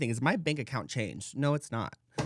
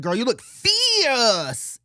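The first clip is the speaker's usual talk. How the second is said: slow, loud, higher sassy drawl of praise; sassy compliment; 7 semitones higher, 7 dB louder, much slower than usual. Retold or imitated. Complimenting a stranger downtown, a loud slow sassy drawl. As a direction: stretch out the praise with full sass. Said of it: "If you say it like that".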